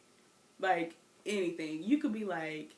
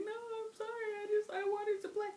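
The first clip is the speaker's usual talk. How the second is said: put-on much higher, whimpering little-girl apology; mock teary kid; 13 semitones higher than usual. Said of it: "I'm like".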